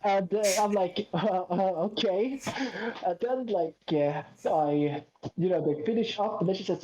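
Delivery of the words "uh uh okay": higher, stammered questioning okay; hesitant okay. Retold or imitated. Customer while hairdresser touches his head, hesitant. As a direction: falter twice, then agree uncertainly. Acts out own uneasy reply.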